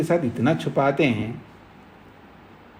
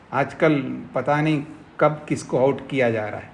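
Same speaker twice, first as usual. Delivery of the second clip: ordinary talk, nothing marked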